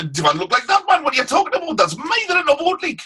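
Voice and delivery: high-pitched broad Geordie demo; Geordie accent. Shown as a demonstration. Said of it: "If a proper Geordie accent".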